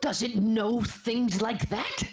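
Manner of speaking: high slow bewildered question; high, slow puzzled. Acted. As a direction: ask high and slowly, baffled. Character puzzled.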